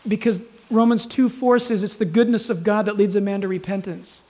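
normal talking voice

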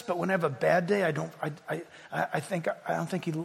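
softly voiced struggling believer, stammering; quietly. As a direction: murmur the doubts with false starts. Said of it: "today, quietly".

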